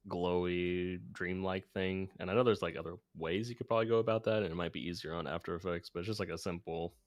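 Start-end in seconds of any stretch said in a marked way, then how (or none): none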